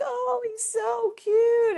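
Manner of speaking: put-on cooing over baby. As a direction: melt over a tiny baby. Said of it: "everyone would be like".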